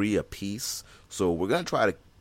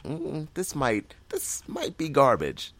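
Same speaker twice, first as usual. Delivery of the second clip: slow, higher-pitched doubtful musing; hesitant, drawn-out inner doubt; skeptical; 8 semitones higher, slower than usual. Retold or imitated. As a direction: voice your misgivings, drawing the words out. Acting out his doubts before watching.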